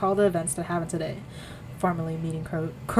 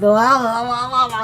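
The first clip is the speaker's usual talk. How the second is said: loud, garbled la-la-la; tongue-tied babble; 4 semitones higher, 8 dB louder than usual. Real, not acted. Reader tripping over Soul's line, tongue-tied babble. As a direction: fumble into babbling after a flubbed line. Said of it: "I can't speak".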